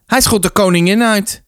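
high-pitched accusing protest. A narrator's reading. Boy telling the rector on Arnold, protesting. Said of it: "protesteerde Martin".

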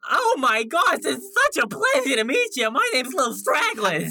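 high, gushing fan voice; starstruck Lil Stragglers. Acted. Starstruck kid meeting an idol, high and excited. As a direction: gush in a high character voice.

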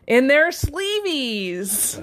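very slow, hammed-up pun delivery; drawn-out punchline. Parent giving kids the dad joke answer, drawn-out punchline. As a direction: stretch the silly pun right out.

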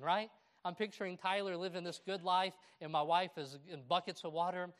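ordinary talk, nothing marked

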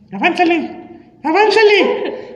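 high whiny mock urging; squeaky impatient mock nagging; pushy stranger. Impatient stranger in bank line.

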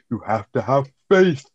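slow, high, hammed-up vampire drawl; mock the villain's theatrical menace; over-the-top vampire. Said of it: "the way he talks is really over the top".

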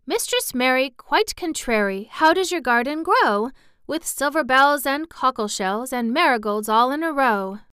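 nursery-rhyme taunt. Retold or imitated. Mary imitating the children's teasing, lilting recitation. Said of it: "They sang".

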